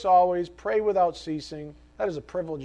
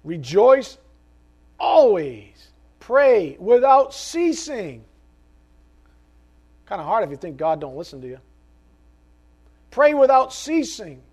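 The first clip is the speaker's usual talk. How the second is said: louder, higher, slow scripture proclamation; full-force preaching; 4 semitones higher, 7 dB louder than usual. Acted. Preacher to the congregation, proclaiming scripture at full force. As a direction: proclaim each word like a command from the pulpit.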